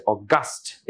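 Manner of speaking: August as "aw-GUST", stress on the end; second-syllable stress. Shown as a demonstration. Teacher introducing the adjective with the same spelling, August stressed on GUST. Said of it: "এখানে একটা stress হয়".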